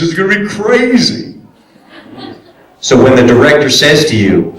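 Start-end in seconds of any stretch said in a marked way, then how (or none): 0.0-1.4 wacky voice. Shown as a demonstration. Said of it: "loud and wacky".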